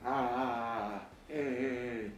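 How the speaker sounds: put-on drawn-out baby vowel babble; slow infant babble; baby babbling. Shown as a demonstration. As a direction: babble like an infant before first words.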